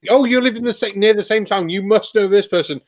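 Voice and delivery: lightly mock the naive assumption; nosy questioner. Imitating people who assume neighbours all know each other, naive. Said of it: "I get people who ask me".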